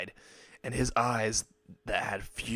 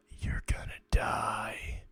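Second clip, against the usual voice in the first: slow, drawn-out menacing whisper; 4 dB quieter, slower than usual. A narrator's reading. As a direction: whisper it slowly into someone's ear. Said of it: "he whispers into her ear".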